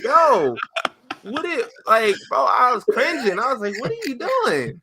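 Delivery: high, loud, hammed-up embarrassment; mortified cringing. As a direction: wail it as if cringing at yourself. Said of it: "I was so ashamed of myself".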